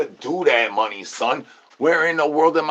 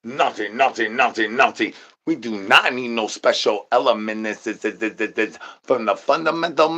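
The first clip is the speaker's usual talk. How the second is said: normal talking voice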